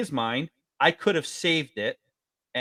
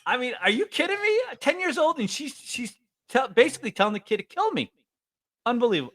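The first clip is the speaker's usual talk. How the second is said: indignant disbelief; 10 semitones higher than usual. Real, not acted. Indignant retelling.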